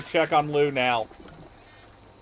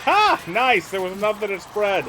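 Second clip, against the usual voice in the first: excited cheer; 6 semitones higher, 8 dB louder than usual. Real, not acted. Fire spreads among enemies.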